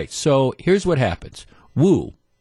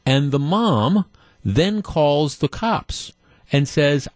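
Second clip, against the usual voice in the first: ordinary talk, nothing marked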